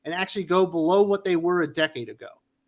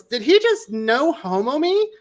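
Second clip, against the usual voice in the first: waiter's offended voice; 7 semitones higher, 3 dB louder, slower than usual. Retold or imitated. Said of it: "and the waiter goes".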